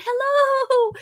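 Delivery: put-on high, slow, babyish hello; cutesy sing-song hello; cutesy greeting. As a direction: coo hello like to a baby. Greeting a viewer cutely.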